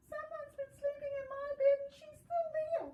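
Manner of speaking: put-on very high, loud baby bear squeal. Baby bear finds the girl still in his bed, high and loud. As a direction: squeal it like an excited little bear.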